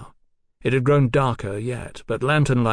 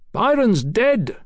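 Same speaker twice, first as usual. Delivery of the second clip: high, slow, ringing proclamation; crying out with conviction; 11 semitones higher, 4 dB louder, slower than usual. A narrator's reading. Captain announces a death to his foe, crying out. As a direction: cry it out as terrible truth. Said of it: "Swing cried".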